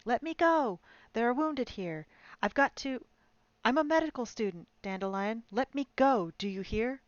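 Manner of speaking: high, loud panicked pleading; hysterical screaming. Acted. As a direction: scream to be let go, frantic. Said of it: "was screaming hysterically".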